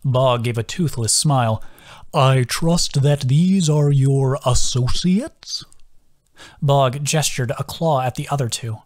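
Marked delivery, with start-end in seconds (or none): 2.1-5.7 put-on slow sing-song voice of a gentle old turtle. A narrator's reading. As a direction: draw each word out in a musical sway. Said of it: "His sing-song voice".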